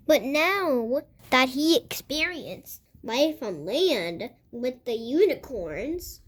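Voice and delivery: plain reading voice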